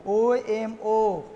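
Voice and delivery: each letter stretched very slowly; dictating. Real, not acted. Teacher giving students the next tool, slow dictation. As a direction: dictate the letters one by one, drawn out.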